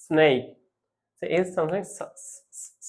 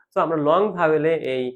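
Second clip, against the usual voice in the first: usual speaking voice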